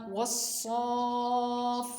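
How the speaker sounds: वस्सॉफ stretched long and slow; drawn-out joined word. Shown as a demonstration. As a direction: sound out वस्सॉफ slowly as a whole word. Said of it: "फा खड़ी ज़बर फा".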